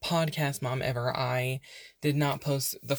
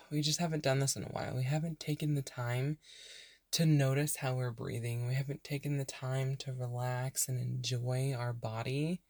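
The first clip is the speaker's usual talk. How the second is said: unhurried meditation voice with long pauses; guided meditation; 5 dB quieter than usual. Real, not acted. Podcast host leading burned-out listeners into breathing, calm meditation voice. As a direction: softly guide them into rest, leaving space to breathe.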